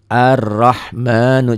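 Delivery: Ar-Rahmanu with a doubled r, drawn out; careful recitation. Shown as a demonstration. Teacher modelling the word for pupils, slow. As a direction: stretch Ar-Rahmanu in a Quranic chant. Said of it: "cirinya ada tasdid ya".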